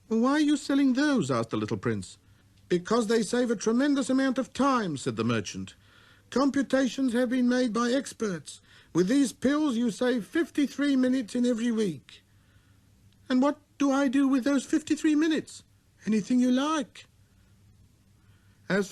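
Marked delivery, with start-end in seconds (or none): 0.1-1.3 put-on high, light boyish voice; a small boy's clear greeting; little prince voice. 2.7-12.2 high, eager merchant; salesman voice. Acted. 13.3-15.6 put-on high, light boyish voice; a small boy's clear greeting; little prince voice. 16.0-17.1 high, eager merchant; salesman voice. Acted.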